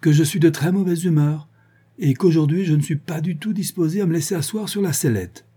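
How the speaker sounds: high-pitched, brusque refusal; testy, abrupt announcement. A narrator's reading. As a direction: put your foot down, pitch up. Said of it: "dit brusquement Liane".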